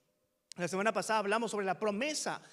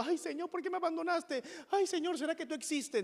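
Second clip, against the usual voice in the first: higher-pitched, plaintive mock lament to God; mock whining complaint; 8 semitones higher than usual. Preacher mimics believers questioning God, mock whining. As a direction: imitate a believer wailing up to God. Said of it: "no estamos llorando".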